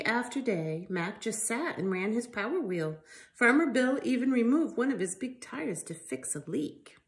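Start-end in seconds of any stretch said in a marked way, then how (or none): none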